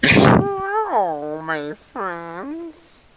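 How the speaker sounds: creepy rat voice. Acted. Creepy greeting as rat skull.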